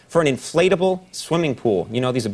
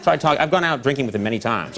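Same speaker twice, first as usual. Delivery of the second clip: ordinary delivery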